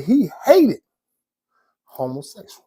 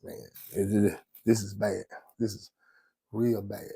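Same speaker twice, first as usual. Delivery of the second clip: low dismayed murmur; 6 semitones lower, 10 dB quieter, slower than usual. Retold or imitated. Dismayed whisper about bad news.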